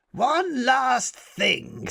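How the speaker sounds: high, very slow and sly; drawn-out sly boy's whisper of a plan; scheming boy. Acted. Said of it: "said Bradley".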